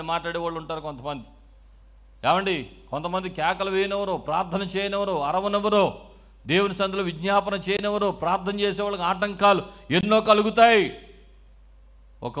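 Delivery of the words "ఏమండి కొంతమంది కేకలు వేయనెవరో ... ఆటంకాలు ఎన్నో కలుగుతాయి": raised, higher-pitched sermon voice; forceful preaching. Real, not acted. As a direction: build in volume through the list to a loud finish.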